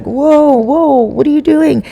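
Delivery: high startled "whoa, whoa"; alarmed confusion. Retold or imitated. Husband dazzled by a flashlight in his eyes, high alarmed confusion. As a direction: recoil in a high, baffled voice.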